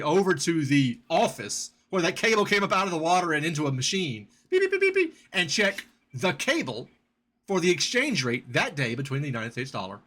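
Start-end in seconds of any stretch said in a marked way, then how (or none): 4.5-5.2 high, quick beeping like a telegraph key; telegraph beeps. Retold or imitated. Host imitating the cable machine at the office, high beeping.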